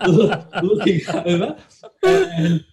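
words broken by real laughter; giggling retelling; laughing through words. Real, not acted. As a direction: laugh as you say looking over. Laughing while retelling the huddle.

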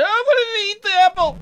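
high loud mock crying whine. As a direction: whine it like a cheated child. Poop character whines.

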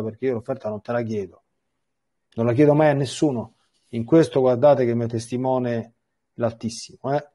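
ordinary talk

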